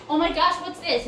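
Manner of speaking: lightly voiced shocked grandparent; a hint of a fussy grandparent scolding; mock scolding grandparent. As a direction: grandparent gasping at a toddler's mess, mildly mocked. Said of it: "they're like".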